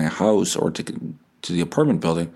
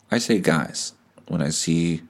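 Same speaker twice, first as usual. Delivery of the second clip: ordinary delivery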